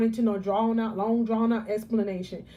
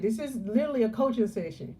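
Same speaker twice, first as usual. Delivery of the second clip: usual speaking voice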